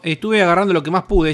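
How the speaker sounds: ordinary delivery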